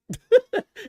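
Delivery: loud high burst of laughter. Real, not acted. Streamer laughing at his own jab, loud high cackle. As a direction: cackle at your own insult.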